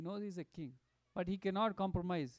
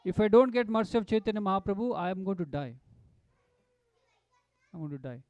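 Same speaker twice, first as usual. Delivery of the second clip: desperate declaration; 11 dB louder than usual. Retold or imitated. King to the devotees, vowing to die, loud then sinking. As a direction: cry out that you will die, then sink. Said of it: "He declares".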